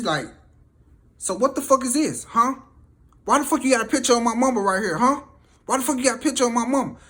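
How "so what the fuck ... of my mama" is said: loud, high, rapid-fire interrogation; acted furious demand. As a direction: demand an answer, imitating an enraged sister. Sister demands answer about photo.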